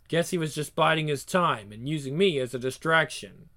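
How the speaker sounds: ordinary talk, nothing marked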